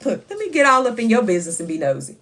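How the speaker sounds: ordinary talk, nothing marked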